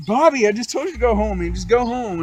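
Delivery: acted-out loud telling-off; exasperated retelling. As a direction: raise your voice in fed-up exasperation. Fed up, scolding Bobby in the retold fight.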